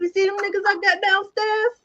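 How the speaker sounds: very high, excited mimicry; Joshua, excited. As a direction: squeal it out like the over-eager villain. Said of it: "He was so excited".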